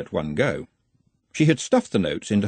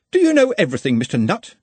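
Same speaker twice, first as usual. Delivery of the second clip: high, mocking schoolmaster tone; sarcastic; 8 semitones higher, 5 dB louder, faster than usual. A narrator's reading. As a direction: sneer like a teacher. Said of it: "with the sarcasm of a born pedagogue".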